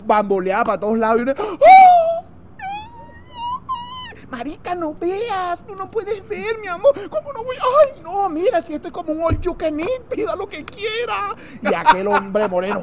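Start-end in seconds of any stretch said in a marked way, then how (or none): 1.4-2.2 loud, very high comic scream; shocked scream. Acted. Women on beach seeing a naked man, hammed-up scream. 4.3-11.3 high, soft hammed-up exclamations; acted beach women. Friends on nudist beach discussing the view, acted high voices.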